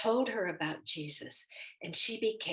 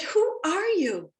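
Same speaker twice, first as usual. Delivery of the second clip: high demanding question; 10 semitones higher, 9 dB louder, slower than usual. Retold or imitated. Demanding the stranger's name.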